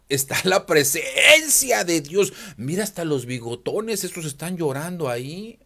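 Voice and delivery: mock believer. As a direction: ham up a gullible churchgoer. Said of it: "dirían". (imitación burlona de un creyente conmovido)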